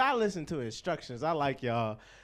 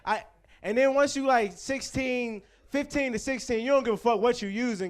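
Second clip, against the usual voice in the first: ordinary talk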